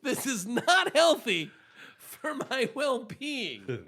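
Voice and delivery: high, slow, pleading complaint; high distressed plea. Retold or imitated. Letter writer's distress, high.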